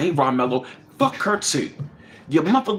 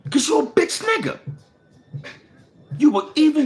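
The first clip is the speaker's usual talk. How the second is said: angry retold yell; 7 semitones higher than usual. Retelling what he yelled at his brother, a furious yell. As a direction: yell the insult up high as if in his face again. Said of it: "I said".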